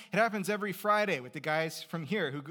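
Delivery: ordinary talk, nothing marked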